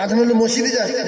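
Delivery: ordinary talk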